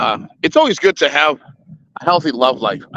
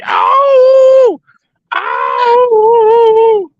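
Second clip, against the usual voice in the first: falsetto "ow" yelps; high yelp; 6 dB louder than usual. Acted. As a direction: squeal it out high and loud. Showing off as Latin Lover.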